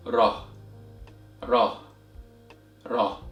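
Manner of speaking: crisp tapped Arabic R in "Ra". Shown as a demonstration. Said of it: "is pronounced as".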